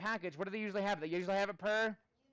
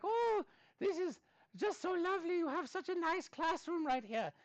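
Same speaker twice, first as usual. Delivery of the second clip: high, sweet imitation of an admiring mom; gushing mom; 10 semitones higher than usual. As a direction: put on mom's gushing voice, pitched well up. Mom admiring the classroom, retold.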